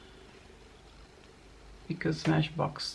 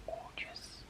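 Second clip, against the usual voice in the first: soft breathy whisper; 19 dB quieter than usual. Real, not acted. Admiring the highlighter to viewers, in a whisper. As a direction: whisper it as if sharing a secret. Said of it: "Why am I whispering?".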